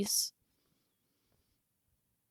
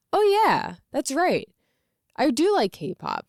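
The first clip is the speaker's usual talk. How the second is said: acted-out dawning realization, high and loud; exclaimed mock "it just came back to me"; sudden recollection; 6 semitones higher, 5 dB louder than usual. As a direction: re-enact suddenly remembering, pitch jumping up. Retold sudden remembering of forgotten interest.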